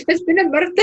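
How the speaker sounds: high mock-Scottish exclamation; hammed-up Glaswegian. Player apologising for their only accent phrase, hammed-up Glaswegian. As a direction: put on a joke Glaswegian accent. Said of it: "that's all I can do".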